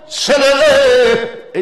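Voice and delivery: high, loud, drawn-out call. Acted. Father summoning his sons over, drawn-out calling. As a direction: holler it with long held vowels. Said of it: "ডাক দিয়ে বলে".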